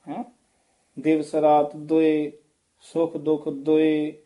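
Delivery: ordinary talk, nothing marked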